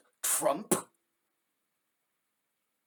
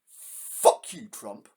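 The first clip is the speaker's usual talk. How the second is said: furious shout; 6 semitones lower, much slower than usual. Real, not acted. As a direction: spit it out in real fury. Reactor cursing at the clip.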